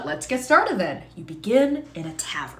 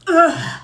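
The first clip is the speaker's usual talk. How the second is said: pained grunt; 11 semitones higher, 9 dB louder than usual. Acted. Stabbed character groans.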